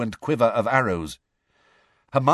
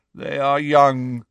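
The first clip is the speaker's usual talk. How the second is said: slow, louder, mournful centaur voice; slow, doleful; 2 semitones higher, 5 dB louder, slower than usual. A narrator's reading. Centaur pleading for the young captives, slow and doleful. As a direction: draw the words out dolefully. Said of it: "said a slow, doleful voice".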